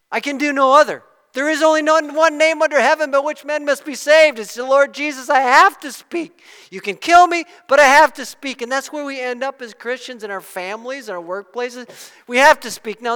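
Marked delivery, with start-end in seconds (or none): 0.1-8.6 loud, higher, unshakable; defiant declaration. Retold or imitated. Peter defying the leaders who will kill him, loud and resolute. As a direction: stand your ground and proclaim it.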